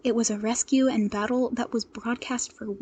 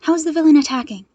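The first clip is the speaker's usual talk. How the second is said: fast, breathless and loud; out of breath; 4 semitones higher, 9 dB louder, faster than usual. Acted. Midoriya back on the line, out of breath. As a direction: rush it out of breath. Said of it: "He sounded out of breath".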